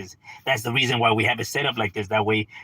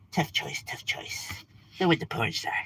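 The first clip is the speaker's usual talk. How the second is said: mock whisper; 8 dB quieter than usual. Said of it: "that motherfucker was like".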